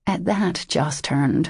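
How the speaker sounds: ordinary delivery, nothing marked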